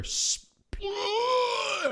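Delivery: long high splatter sound effect; imitated splatter noise.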